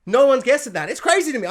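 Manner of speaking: loud, worked-up exclamation; exasperated disbelief. Real, not acted. Exclaims in disbelief after deadpan list.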